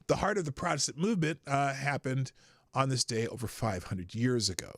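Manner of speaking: usual speaking voice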